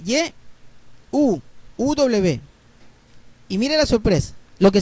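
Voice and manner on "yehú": "Yehú" as ye... u, starting with a Y sound. Shown as a demonstration. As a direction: spell out "Yehú" by sound, pausing before the u. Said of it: "Y E H". ("Yehú" con Y inicial y pausa antes de la u)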